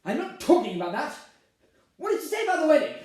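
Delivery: high, loud, exasperated demand; angry interrogation. Acted. Bride demanding what his mother said, exasperated. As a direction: rise sharply as you press him.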